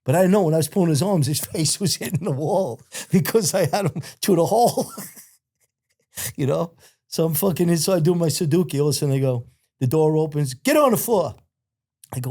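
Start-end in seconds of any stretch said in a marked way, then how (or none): 1.3-4.9 laughter breaking into the words, pitched up; laughing while talking. Real, not acted. Storyteller recalling pulling a man's arm through, laughing. 10.5-11.3 high-pitched imitated order; retold command. Guards coming through the opened door, high retold command.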